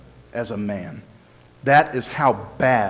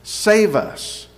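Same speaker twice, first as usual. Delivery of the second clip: mildly imploring, pitched higher; retold crowd plea; 6 semitones higher than usual. Crowd crying Hosanna to be saved, retold as a raised plea. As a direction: plead on the crowd's behalf, voice lifted. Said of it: "That's what they were shouting".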